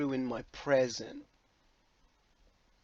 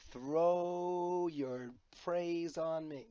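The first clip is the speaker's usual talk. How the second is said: very slow, drawn-out joke demand; mock-grandiose; 3 semitones higher, much slower than usual. Vlogger jokingly asking praise for charity money, mock-grandiose and drawn out. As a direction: stretch each word out grandly.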